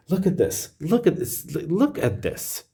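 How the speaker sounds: high excited look at this; thrilled, pointing it out. Real, not acted. Reader showing Gemini the wealth card, high excitement.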